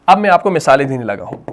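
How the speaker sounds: usual speaking voice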